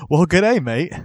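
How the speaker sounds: a joking Aussie greeting in a put-on Australian accent; mishearing Austria, jokes to cohost in mock Australian accent. Lightly hammed Australian accent.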